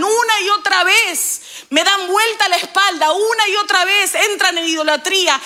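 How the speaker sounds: high, fast, driving sermon build; the pastor stirs the congregation with rising fervour; fiery preaching. Acted. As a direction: preach it, pressing each repeated phrase. Preacher builds to full force.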